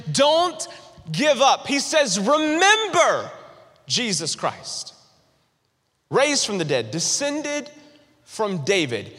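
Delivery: Paul's plea, pushed high then dropping; preaching emphasis. Retold or imitated. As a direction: preach Paul's words with urgent rises.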